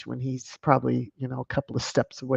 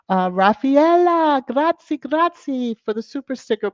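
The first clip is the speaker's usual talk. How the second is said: put-on light Italian accent; mock Italian; 10 semitones higher, 4 dB louder than usual. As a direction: lean into a playful Italian grazie. Thanking Italian viewer.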